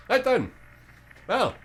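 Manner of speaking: high, loud, oddly cheerful creature; a chipper monster eager to bargain. Acted. GM's bone creature answers the party, high and chirpy.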